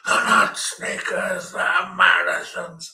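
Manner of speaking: mock him correcting the kids in a temper; fuming husband. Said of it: "Oh, he was fuming".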